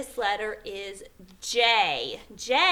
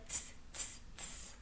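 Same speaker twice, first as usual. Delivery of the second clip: soft, voiceless "tss" noises; monkey noise; 21 dB quieter than usual. Acted. Monkeys mocking the peddler's finger-shaking, soft tss noises. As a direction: tut back softly in mimicry. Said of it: "the monkeys only took their fingers and said".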